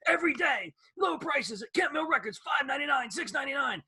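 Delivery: high, shouty hard-sell announcer; obnoxious ad voice. Retold or imitated. Host mimics the record-store ad announcer, shouting. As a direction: yell the sale like a pushy TV ad. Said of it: "this obnoxious voice is going".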